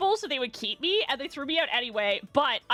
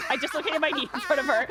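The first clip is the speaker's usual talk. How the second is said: ordinary talk, nothing marked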